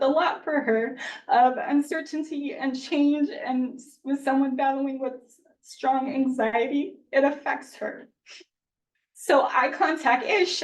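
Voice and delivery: emotional. Real, not acted. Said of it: "Sorry, I'm being emotional".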